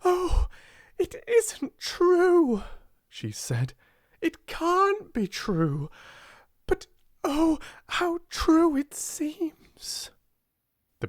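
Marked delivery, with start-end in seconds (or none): 0.0-2.6 very high, loud breathless cry. A narrator's reading. Girl overwhelmed by her transformed room, crying out. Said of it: "cried out again". 4.2-10.1 high, slow, breathy amazement; crying out. A narrator's reading. Girl marvelling that it seems true, crying out. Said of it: "cried out again".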